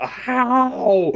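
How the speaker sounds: high, loud disbelieving How; the boss yelps in disbelief; shocked how. Acted. Alter hearing the mess is in the molecules, high loud yelp.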